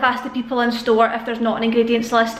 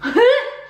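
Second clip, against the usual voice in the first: sharp gasp; 12 semitones higher, 3 dB louder than usual. Real, not acted.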